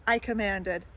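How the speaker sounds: ordinary delivery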